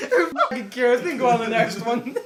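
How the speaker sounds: laughing. Real, not acted. Laughing dismissal.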